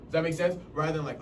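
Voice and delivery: normal talking voice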